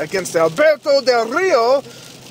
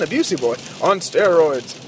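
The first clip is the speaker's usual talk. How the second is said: ordinary talk, nothing marked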